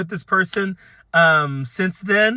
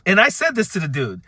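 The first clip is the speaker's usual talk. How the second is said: ordinary talk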